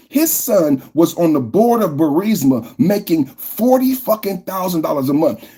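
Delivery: usual speaking voice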